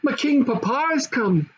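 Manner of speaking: put-on high, delighted girl's voice; joyful. A narrator's reading. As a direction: share the happy news with glee. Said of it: "clapping her hands right joyfully".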